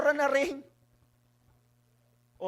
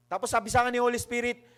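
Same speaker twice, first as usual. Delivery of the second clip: normal talking voice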